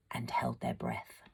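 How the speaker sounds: breathy, barely voiced whisper. A narrator's reading. Narrator at the tense moment the fuses are lit, whispered. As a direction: whisper it as if holding your own breath.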